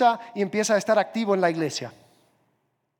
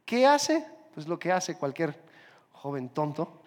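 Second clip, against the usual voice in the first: ordinary delivery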